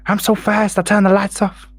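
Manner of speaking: lightly voiced southern boxer brag; mock Ali voice. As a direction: toss it off like Ali bragging. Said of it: "He's kind of breathless when he talks".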